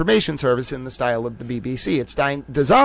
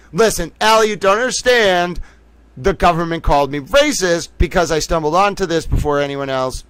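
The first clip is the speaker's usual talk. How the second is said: normal talking voice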